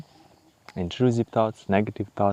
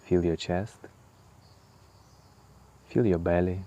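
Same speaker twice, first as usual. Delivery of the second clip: guided meditation; 5 dB quieter than usual. Acted. Guiding the listener through breath and body scan, calm meditation voice. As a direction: ease the listener inward, unhurried.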